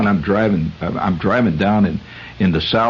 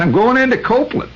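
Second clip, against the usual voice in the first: put-on Southern accent. Driver telling the attendant his destination, in a high Southern accent. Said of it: "I said".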